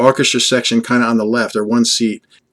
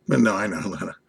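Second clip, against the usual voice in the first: usual speaking voice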